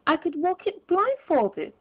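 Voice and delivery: fervent. Acted. Scrooge boasts to the spirit, fervent and eager. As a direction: insist with joyful conviction. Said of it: "cried Scrooge with fervour".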